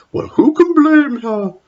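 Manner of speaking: cruel chuckle in the voice; mocking laugh. Matron mocking the porter, laughing. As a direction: laugh it off nastily. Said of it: "laughed Matron".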